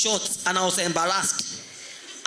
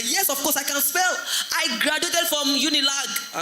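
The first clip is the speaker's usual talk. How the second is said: affronted boast; 6 semitones higher than usual. Acted. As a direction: play an affronted teacher showing off credentials. Teacher indignantly defends her ability.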